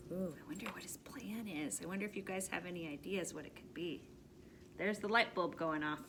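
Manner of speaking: conspiratorial whisper, quick; whispered aside. Real, not acted. Reader wondering aloud with listeners, hushed whisper. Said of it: "reader whispers aside to kids".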